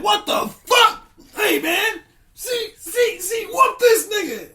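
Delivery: very high, loud, half-laughing squeal; shrieking outrage. Acted. Gods freaking out at the neutral god, shrieking. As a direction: shriek in comic panic. Other gods react to the bomb.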